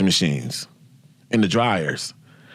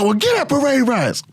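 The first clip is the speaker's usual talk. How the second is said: much higher put-on barking voice; drill sergeant; 14 semitones higher than usual. Sergeant calling out the private, high stern call. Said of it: "She said".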